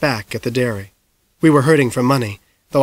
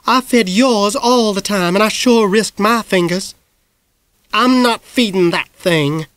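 high, slow, huffy exchange; bickering sisters; 7 semitones higher than usual. A narrator's reading. The sisters bickering over feeding the parrot, huffy. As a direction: trade complaints stubbornly.

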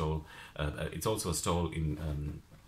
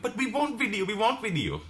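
high, pleading insistence; pleading friend; 13 semitones higher, 3 dB louder than usual. Retold or imitated. Pub friend begging her for videos, high and pleading. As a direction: beg for the video in a much higher voice. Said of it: "And then he goes".

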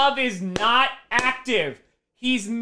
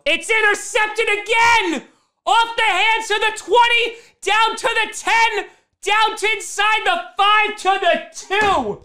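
high-pitched, stretched live call; agitated fan commentary; alarmed play-by-play; 10 semitones higher than usual. Real, not acted. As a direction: call the disaster as it unfolds. Calls a second interception live.

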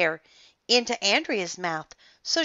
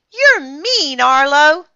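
put-on high, loud indignant girl; angry girl; 8 semitones higher, 10 dB louder, slower than usual. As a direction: protest loudly, high and hurt. Girl angrily scolding Arlo.

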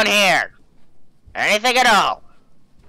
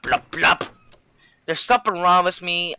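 ordinary talk, nothing marked

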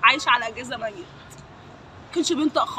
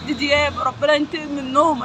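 ordinary talk, nothing marked

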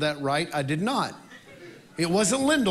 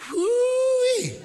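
high, loud laughing whoop; joyful whoop; 4 dB louder than usual. Real, not acted. Worship leader whooping to the congregation, laughing. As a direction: whoop with delight at the crowd.

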